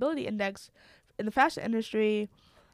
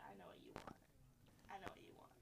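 whispering as an all-knowing ad; mock creepy whisper; 2 semitones lower, 28 dB quieter, much faster than usual. Instagram ad to user it was listening to, creepy whisper. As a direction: imitate Instagram in a hushed whisper.

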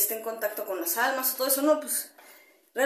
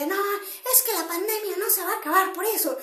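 imitating a lady shouting across the plaza; pitched-up imitation of an angry stranger; retold yelling; 8 semitones higher than usual. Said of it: "una señora a gritarme así".

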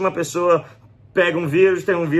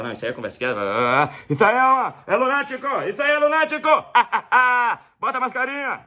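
dismissive mayor; 8 semitones higher than usual. Retold or imitated. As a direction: brush off the panic as nonsense with a laugh. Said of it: "o prefeito fala".

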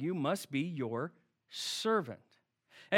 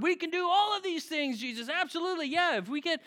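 much higher, bouncy eager promise; youthful enthusiasm; 7 semitones higher than usual. Retold or imitated. Disciples promising Jesus anything, much higher and bursting with eagerness. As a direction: gush like eager young disciples. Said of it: "this youthful enthusiasm comes forward".

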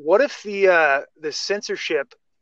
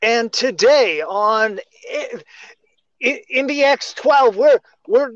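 high, slow, halting newsreader voice with a choked edge; news anchor impression; 7 semitones higher, 4 dB louder, slower than usual. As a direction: announce the broadcast like a hammed-up TV anchor. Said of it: "news anchor would be like".

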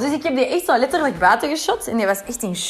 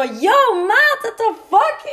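retold outburst; 10 semitones higher, 5 dB louder, much slower than usual. Confronting friend yelling at night.

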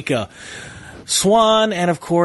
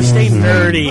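radio sign-off voice; 6 semitones lower, 6 dB louder, slower than usual. Acted. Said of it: "I haven't done that in forever".

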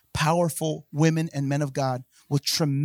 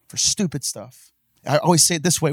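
ordinary delivery

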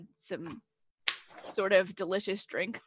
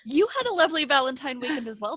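excited; 6 semitones higher, 12 dB louder than usual. Real, not acted. Excited prompt.

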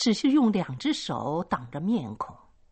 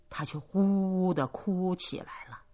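usual speaking voice

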